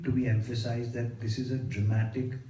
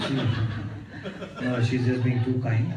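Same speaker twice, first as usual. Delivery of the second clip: modest laugh through words; much slower than usual. Real, not acted. Husband laughing off his wife's praise.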